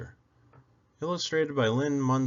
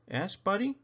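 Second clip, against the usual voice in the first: ordinary delivery, nothing marked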